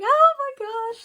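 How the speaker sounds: high, loud delighted squeal; a sudden burst of high, loud glee; excited squeal. Retold or imitated. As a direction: squeal it out in a thrill of surprise. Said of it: "And it was so exciting".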